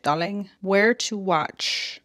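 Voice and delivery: ordinary talk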